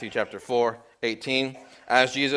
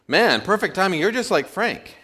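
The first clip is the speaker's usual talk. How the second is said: chatty cabbie; 8 semitones higher, 3 dB louder than usual. Retold or imitated. Joke, cabbie talking to passenger.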